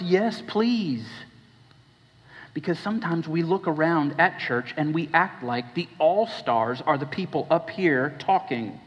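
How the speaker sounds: ordinary talk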